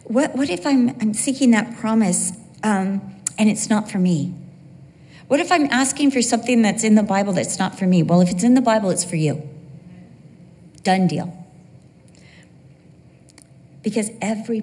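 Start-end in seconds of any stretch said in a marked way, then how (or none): none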